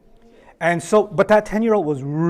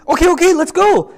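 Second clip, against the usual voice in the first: eager hurry; 10 semitones higher, 8 dB louder than usual. Acted. Women eager to find nurse.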